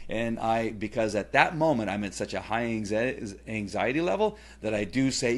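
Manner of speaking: ordinary talk, nothing marked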